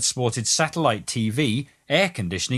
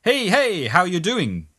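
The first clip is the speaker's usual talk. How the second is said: high, pushy street hustler's call; spring out with a raised, high hail; yelled greeting; 7 semitones higher, 4 dB louder, slower than usual. A narrator's reading. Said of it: "he springs out yelling".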